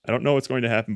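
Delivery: usual speaking voice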